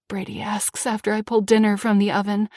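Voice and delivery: plain reading voice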